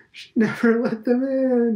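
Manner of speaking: high, loud cry through laughter; laughing. A narrator's reading. Old man repeating his joke to Stephen, laughing loudly. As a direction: crow it while laughing. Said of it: "he cried again through his laughter".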